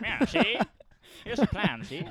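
tough guy movie mobster; old-timey gangster voice. Acted. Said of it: "I just love the old-timey voice".

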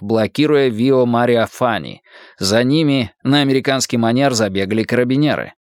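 ordinary delivery

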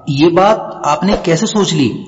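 usual speaking voice

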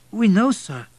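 high, loud servant voice; eager, high-voiced retainer; innocent butler. A narrator's reading. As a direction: answer innocently in a high-pitched voice. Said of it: "replied the butler innocently".

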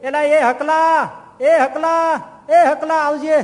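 loud, high call across the house, dragged out; calling out to son. Retold or imitated. Dying man calls loudly to his son, a high drawn-out shout. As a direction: holler for the boy to come, slowly. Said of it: "તે એના છોકરાને બૂમ પાડી".

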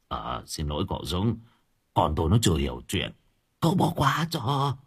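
low, soft, cringing plea; humble apology. Acted. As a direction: grovel to the bully. Father apologizes to Dũng. (ông Xeo hạ giọng xin lỗi)

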